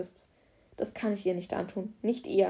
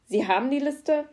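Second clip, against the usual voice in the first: higher and louder, hurried; stressed demand; 6 semitones higher, 6 dB louder than usual. Acted. Stressed officer to the garage boss, loud demand. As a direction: burst in demanding the list.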